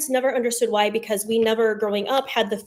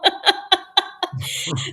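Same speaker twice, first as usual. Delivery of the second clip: a high, airless burst of laughter; high breathy laugh; 9 semitones higher than usual. Real, not acted. Host laughing at her own bad French, high and breathy. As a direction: laugh it off at yourself.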